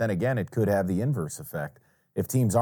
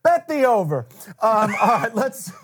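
laughing; 11 semitones higher, 5 dB louder than usual. Real, not acted. Laughing after Miss Cleo clip.

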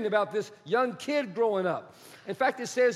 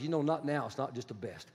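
low, soft, tender refusal; God's gentle no; 9 semitones lower, 8 dB quieter than usual. Acted. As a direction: fade to almost nothing, lovingly.

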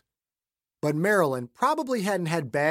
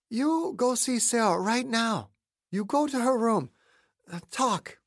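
put-on a childlike higher Jean giving orders; Jean's voice; 8 semitones higher than usual. Jean ordering him to Sarah.

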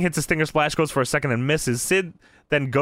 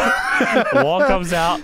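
hammed-up entrance call; 3 semitones higher, 4 dB louder, much slower than usual. Podcaster announces the wall comes out, slow mock shout. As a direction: holler it slowly, amused.

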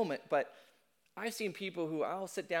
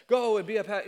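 mock booming God voice; 3 semitones higher, 7 dB louder than usual. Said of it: "I don't know why he speaks like that".

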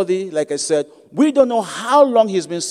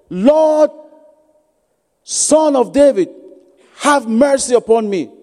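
shouting plea; 4 semitones higher than usual. Acted. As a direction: call out across the crowd.

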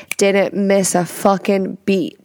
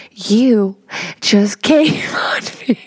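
laughing; 2 semitones higher, 3 dB louder, slower than usual. Retold or imitated. Retelling confronting husband, laughing.

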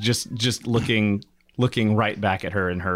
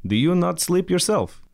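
higher, curious question; Svoltier voice; 10 semitones higher than usual. Acted. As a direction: ask the hostess lightly. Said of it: "Svoltier's gonna be like".

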